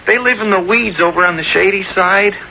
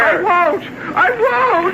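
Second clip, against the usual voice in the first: put-on high, stubborn child's refusal, rising higher; defiant refusal; 13 semitones higher, slower than usual. Boy refusing the man calling him down off the rock, stubborn and high. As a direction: dig your heels in like a stubborn boy.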